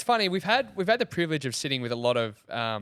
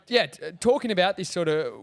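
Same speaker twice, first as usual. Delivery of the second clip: ordinary delivery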